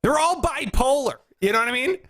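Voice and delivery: ordinary talk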